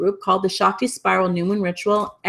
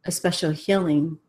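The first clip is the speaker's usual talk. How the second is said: guided meditation blessing. Real, not acted. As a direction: guide them gently into a closing blessing, breathing between phrases. Leads closing meditation and blessing.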